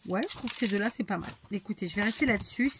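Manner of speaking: normal talking voice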